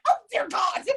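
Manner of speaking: high mock gasp of dismay; embarrassed surprise. Recognising a masked friend, mock embarrassed surprise. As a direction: catch yourself, half laughing at it. Realising she knows her.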